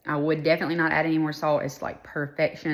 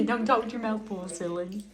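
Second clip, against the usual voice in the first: laughing gentle scold; 5 semitones higher, 3 dB quieter than usual. Real, not acted. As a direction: scold him through a laugh. Mother teasing child, laughing.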